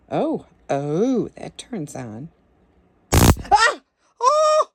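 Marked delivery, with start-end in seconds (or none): 3.1-4.7 sharp high startled cries; startled yelp. Real, not acted. Startled by the device, sharp high yelps. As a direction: jump at the sudden zap.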